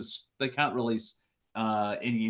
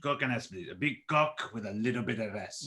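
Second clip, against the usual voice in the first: put-on French accent. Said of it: "in a French accent".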